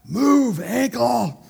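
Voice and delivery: very loud, high-pitched shouted command; yell. Retold or imitated. Rose told to yell at the ankle, full acted yell. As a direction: bellow it with the pitch way up. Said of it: "Rose, yell at the ankle".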